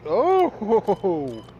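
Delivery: sudden loud high Oh; surprised exclamation. Retold or imitated. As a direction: blurt it out in shock. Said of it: "he's like".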